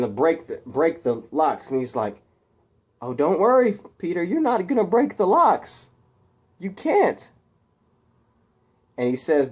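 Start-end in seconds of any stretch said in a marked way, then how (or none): 3.0-7.3 high-pitched taunting reassurance; mocking reassurance. Harry watching Peter struggle with the locks, mocking reassurance.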